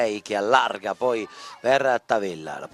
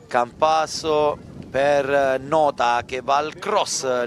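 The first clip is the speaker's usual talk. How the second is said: ordinary talk, nothing marked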